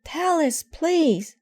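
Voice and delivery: very high, pleading cry; begging. Acted. Sister begging crying Mama, very high and pleading. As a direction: beg her desperately to tell. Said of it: "begged Sister".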